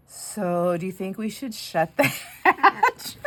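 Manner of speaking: slow, deadpan question with a laugh breaking in; calm deadpan question that cracks into a laugh; very calmly. Retold or imitated. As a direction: ask it slow and matter-of-fact. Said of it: "very, very calmly".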